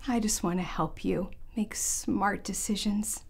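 soft, slow motherly whisper; tender whisper. Acted. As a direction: reassure him gently in a whisper. Tender mother comforting her son.

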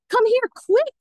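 high-pitched, urgent summons; excited cry. A narrator's reading. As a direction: cry out for someone to come at once. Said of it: "cried Daisy at the window".

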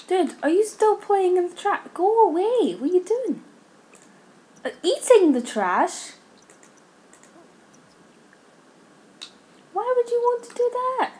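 high-pitched, incredulous telling-off; exasperated scolding. Real, not acted. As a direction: scold in high, disbelieving dismay. Scolding game creature for eating trash.

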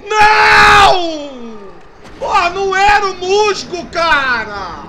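loud angry outburst; raging gamer. Real, not acted. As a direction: shout the mistake out furiously.

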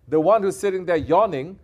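loud, high rebuke rising to a peak; angry scolding. Real, not acted. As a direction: hammer the careless listener. Scolds yawning listener again.

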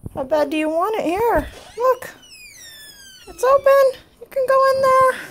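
high, bright coaxing voice to cat; pet talk. Real, not acted. Coaxing cat through door.

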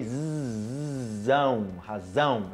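"razão" with a bee-like zzz; buzzing Z like a bee. Shown as a demonstration. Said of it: "uma abelha".

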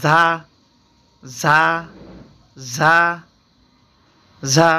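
Arabic zay with a hiss; whistled za. Shown as a demonstration. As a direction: hiss ঝা through the front teeth. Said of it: "শীষ দিয়ে পড়তে হয়".

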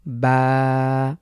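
বা held about a second from relaxed lips; বা with lips resting, drawn for one beat; demo of ba. Shown as a demonstration. Arabic tutor demonstrating বা with a one-second hold. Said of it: "আমরা এক second পরিমাণ টানব".

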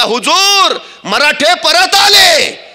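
high, drawn-out yell from the rear; spy crying out urgent news to his lord; shouted alarm. Acted. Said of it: "मागचा हेर ओरडला". (हेराची लांबलचक आरोळी)

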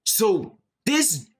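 high, slow stunned exclamation; stunned realisation. Real, not acted. Gamer realises who the killer is. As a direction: say it in stunned disbelief.

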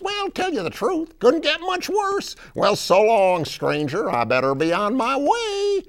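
high, loud, folksy frog character; big high-pitched frog voice; Beauregard the Frog. Acted. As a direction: introduce yourself as a proud cartoon frog. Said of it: "Beauregard the Frog".

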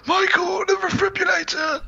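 very high, strained dramatic cry; hammed-up doctor voice. Doctor calls to Michael about defibrillator, high hammed-up voice. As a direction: call out in a high melodramatic old-film voice. Reprises the Donald Pleasance voice.

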